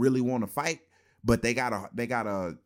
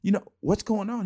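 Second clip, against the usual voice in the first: asking a teammate; 9 semitones higher than usual. Retold or imitated. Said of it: "I'm like".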